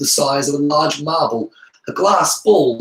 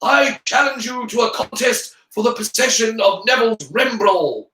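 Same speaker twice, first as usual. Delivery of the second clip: raised, declaimed challenge; shouted challenge; 7 semitones higher, 3 dB louder than usual. A narrator's reading. Harry challenging Goyle for Neville's ball, shouting. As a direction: proclaim it to everyone. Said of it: "screamed Harry".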